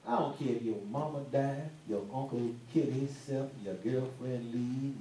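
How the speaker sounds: ordinary talk, nothing marked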